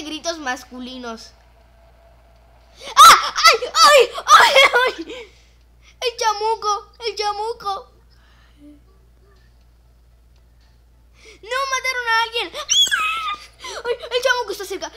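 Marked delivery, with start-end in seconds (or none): 2.9-5.3 loud high laughing screams; screaming. Real, not acted. Screaming in fright. 6.0-14.9 high loud frightened cries; panicked. Real, not acted. Panicked about the devil.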